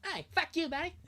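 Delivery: high-pitched cartoon Canadian insult; South Park Canadian. Cursing out a buddy, South Park Canadian voice. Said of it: "South Park voice is insane".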